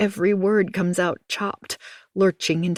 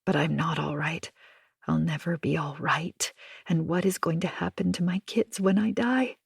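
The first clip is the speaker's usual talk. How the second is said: plain reading voice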